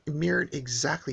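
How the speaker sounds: usual speaking voice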